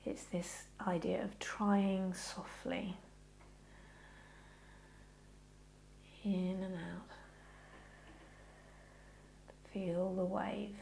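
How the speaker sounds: slow soft breathing guidance; gentle yoga teacher cue; guided meditation. Acted. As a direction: ease them into the breath, slowly.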